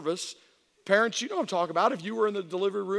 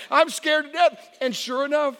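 normal talking voice

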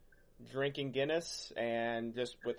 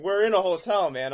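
loud, high protest; louder and higher, protesting; indignant mock protest; 6 semitones higher, 11 dB louder than usual. His protest, quoted.

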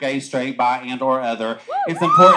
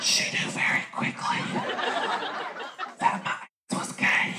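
whisper; 8 dB quieter than usual. Real, not acted. Whispered confession to the crowd.

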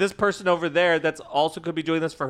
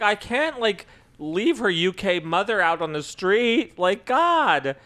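exasperated outburst; 7 semitones higher, 4 dB louder, slower than usual. Retold or imitated. Retelling own reaction to story.

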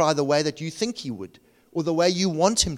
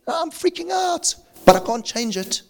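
mock panic; 13 semitones higher than usual. Acting out a worrier.

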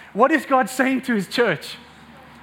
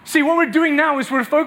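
ordinary delivery

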